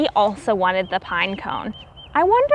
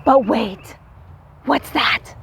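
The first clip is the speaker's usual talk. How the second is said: lively read-aloud storybook voice, climbing higher at the discovery; storybook narration; 3 semitones higher than usual. A narrator's reading. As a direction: build the excitement for the children as the nuts appear.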